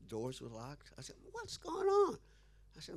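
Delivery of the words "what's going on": high, startled what's going on; suddenly thrown, voice jumps way up; a high yelp of confusion; surprised outcry. Retold or imitated. Finds class doors locked.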